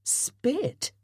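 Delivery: high startled question. Acted. Fenella startled by the colonel's order, high startled question. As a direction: blurt it in disbelief. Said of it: "She looked startled".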